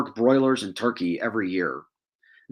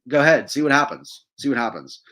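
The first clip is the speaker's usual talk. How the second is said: a loud, higher dare easing off on the repeat; daring, mock invitation; taunting; 4 semitones higher, 5 dB louder than usual. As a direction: goad them into trying it. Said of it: "he's basically taunting and saying".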